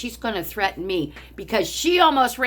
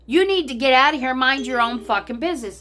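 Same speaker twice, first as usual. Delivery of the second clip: retold angry retort; 6 semitones higher, 4 dB louder than usual. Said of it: "I said".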